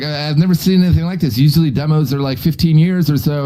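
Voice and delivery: awed nerd rattling off numbers; nerdy scientist voice. Retold or imitated. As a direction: play a flustered scientist reading data. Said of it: "they're like".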